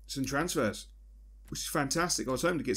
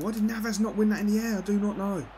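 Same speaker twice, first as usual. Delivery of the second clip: exasperated rant; 8 semitones higher, 3 dB louder, faster than usual. Real, not acted. Ranting at defender.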